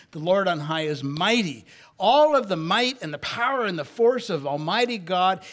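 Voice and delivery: loud, rising pulpit fervour; preaching at full force. Acted.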